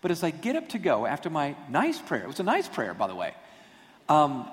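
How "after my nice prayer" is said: ordinary delivery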